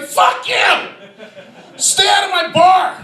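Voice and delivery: high-pitched outraged shout; mock angry yell. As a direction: act out a dad yelling at his kid. Father yells at toddler in story.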